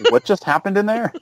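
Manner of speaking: high-pitched imagined reaction; baffled fiancée. Retold or imitated. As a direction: imitate a bewildered partner overhearing from the next room. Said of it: "listening, like".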